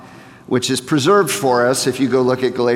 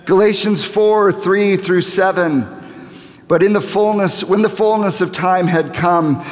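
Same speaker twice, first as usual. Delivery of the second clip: scripture preaching; 5 semitones higher than usual. Acted. Reading scripture, raised preaching voice.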